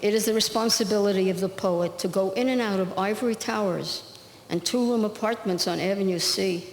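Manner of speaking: measured, rhythmic poetry recital; poem reading. Acted. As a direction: read it like a poem to an audience. Reads her poem aloud.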